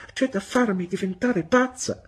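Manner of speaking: very high, loud, overwrought cry; exasperated exclamation. A narrator's reading. Mother at her wits' end before child and servant, exclaiming. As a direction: exclaim as if driven to distraction. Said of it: "esclamò mia madre".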